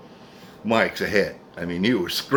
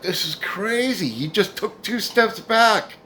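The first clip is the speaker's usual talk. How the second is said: amazed; 11 semitones higher, slower than usual. Retold or imitated. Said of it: "and I was like".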